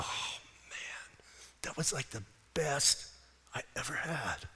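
hushed, breathy delight, fading away; a soft, savoring whisper of rapture. Pretend. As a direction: whisper it like the best taste ever. People savoring your goodness.

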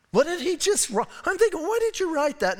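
high, bewildered asking why; mock disbelief at the text; baffled. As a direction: sound truly thrown by the verse. Pastor baffled by verse.

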